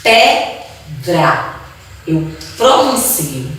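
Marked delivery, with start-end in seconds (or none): 0.0-1.6 PEDRA broken into two beats, pe, dra; teacher's syllable-by-syllable demo of pedra. Shown as a demonstration. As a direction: say pedra slowly with a gap between syllables. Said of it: "abrindo a boca duas vezes".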